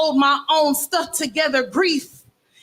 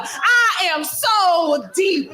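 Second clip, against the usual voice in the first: higher, slow, through laughter; laughing mock profound; 7 semitones higher, much slower than usual. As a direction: drag it out while cracking up. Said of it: "in this tone of voice".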